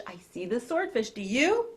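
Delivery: plain reading voice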